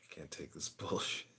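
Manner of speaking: hushed, breathy mock complaint under the breath; whispered quote. Narrating Cora waking up, a whispered fed-up quote. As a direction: whisper her fed-up line with a laugh. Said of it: "She was like".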